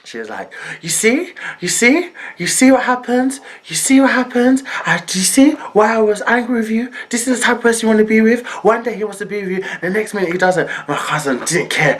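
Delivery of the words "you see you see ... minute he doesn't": imitated telling-off, pitched up; scolding auntie. Auntie telling her off about a man, scolding. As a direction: push it higher, insistent I-told-you-so.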